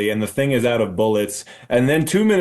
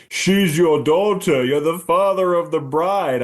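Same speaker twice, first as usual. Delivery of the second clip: hammed-up, drawn-out line, pitched higher; mock movie reveal; 5 semitones higher, slower than usual. Host mimicking the film's big daughter reveal, hammed-up. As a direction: declare it slowly like a big dramatic reveal.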